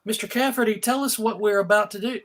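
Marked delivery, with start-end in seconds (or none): none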